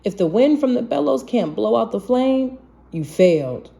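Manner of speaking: plain reading voice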